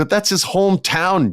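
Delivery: high, loud indignant jab; mock outrage. Telling the booker off over the hometown crowd. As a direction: call him out, half joking.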